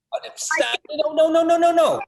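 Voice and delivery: high, loud repeated no, rising in panic; desperate protest. Acted. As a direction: cry out to stop them leaving. Protests as the others leave.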